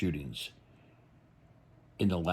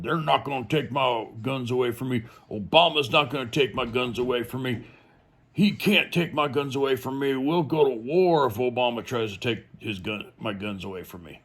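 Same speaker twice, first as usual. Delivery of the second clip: higher, louder indignant gun-nut impression; mocking gun owners; 5 semitones higher, 3 dB louder than usual. Gun people after Sandy Hook vowing to resist, mocking defiant rant. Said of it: "all these gun people going".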